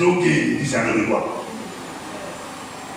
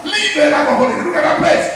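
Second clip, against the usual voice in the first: raised, insistent preacher's delivery; forceful preaching; 7 semitones higher, 3 dB louder than usual. Real, not acted. Preacher decrees over the congregation's enemies, forceful preaching. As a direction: preach it hard, slowing into the decree.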